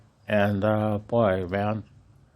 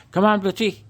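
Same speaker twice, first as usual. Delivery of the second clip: loud, high call across the yard; shouting for the dog; 14 semitones higher, 8 dB louder, faster than usual. Real, not acted. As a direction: belt out the dog's name to bring him back. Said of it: "Sorry for shouting".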